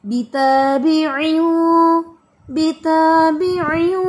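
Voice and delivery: बिताबिउ very slow and loud, nasal held heavy; heavy ikhfa. Shown as a demonstration. Teacher demonstrating heavy ikhfa to student, loud and slow. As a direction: draw बिताबिउ out full and heavy. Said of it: "वो heavy होना चाहिए".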